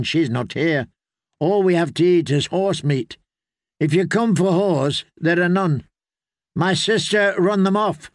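put-on girl's voice, matter-of-fact and guarded; wary young girl. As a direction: answer the strangers like a weary girl. Willow, a girl, answers guests.

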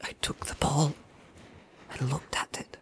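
shaken, stammering whisper; frightened stammer. Acted. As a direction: stammer it out in terror. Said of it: "stammered Pippin".

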